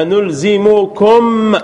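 أنلزمكم said slowly, each syllable apart; slow base form. Shown as a demonstration. Teacher giving the original form, slow careful أنلزمكم. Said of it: "هذا الأصل".

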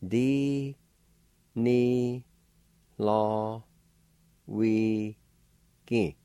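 "Di, ni, lo, wi, gi" in long, separate syllables; syllable-by-syllable pronunciation. Shown as a demonstration. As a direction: draw out each of "Di, ni, lo, wi, gi" with pauses between. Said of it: "It's pronounced like this".

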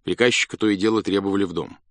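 plain reading voice

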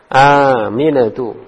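AMINATU with the first A held long; long vowel. Shown as a demonstration. Teacher correcting a student's reading, drawn-out first vowel in "Aminatu". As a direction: stretch the opening "Aa" of "Aminatu", slowly. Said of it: "Hanya panjang".

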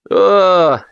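loud, high "ah!"; dismissive. A narrator's reading. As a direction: wave it away with one cry. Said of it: "отмахнулся Кытин вилкой".

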